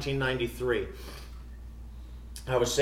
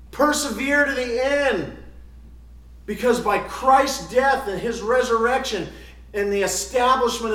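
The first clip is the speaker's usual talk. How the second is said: loud, high, driving sermon delivery; full-force preaching; 8 semitones higher, 8 dB louder than usual. Real, not acted. Preacher urging his congregation to persevere, full-force preaching. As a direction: press it home with punched key words.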